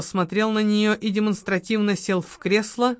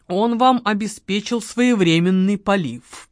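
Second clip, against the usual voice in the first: ordinary delivery, nothing marked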